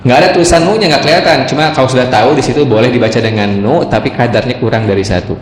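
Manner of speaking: normal talking voice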